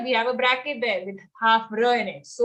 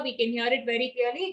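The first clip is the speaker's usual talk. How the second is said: ordinary talk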